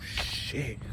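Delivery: hushed alarmed whisper; whispered oh shit. Retold or imitated. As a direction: breathe it out in dread. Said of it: "I'm like".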